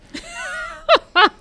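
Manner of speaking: high burst of laughter; laughing. Real, not acted. [name] bursts out laughing at his own bad accent. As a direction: break up laughing at yourself. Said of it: "laughs at himself".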